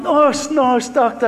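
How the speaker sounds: high, loud acted shout for staff; panicked retold yell; urgent call for help. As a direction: re-enact calling down a hospital ward in alarm. Acting out calling for urgent help.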